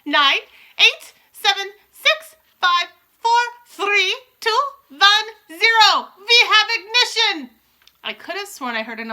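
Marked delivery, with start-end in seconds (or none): 0.1-7.5 high, loud, spaced-out count; slow, ringing witch proclamation; shouted countdown. A narrator's reading. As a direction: call each number, building to a triumphant finish. Witch's launch countdown, building to climax.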